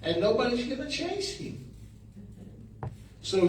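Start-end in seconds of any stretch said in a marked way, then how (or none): 0.0-1.7 lightly voiced colleague, higher and quick at first; a hint of a skeptical colleague; quoting a colleague. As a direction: toss off the colleague's doubtful warning in a hint of his voice. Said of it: "he said".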